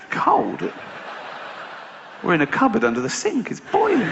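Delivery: high squeaky disbelief; incredulous spider. Retold or imitated. Spider friends arguing.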